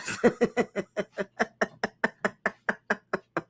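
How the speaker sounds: soft real chuckle; quiet laugh. Real, not acted. Laughing quietly at the phony guy's lies. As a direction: let a quiet laugh slip out.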